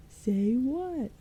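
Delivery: high, dim goblin question; lightly voiced dim goblin; stupid goblin. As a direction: ask like a dopey goblin. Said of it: "asked a stupid goblin".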